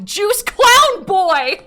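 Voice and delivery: high, loud, slow laughing wail; mock-crying shout. Real, not acted. Streamer mocking her younger self, laughing shout. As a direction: howl it out at yourself.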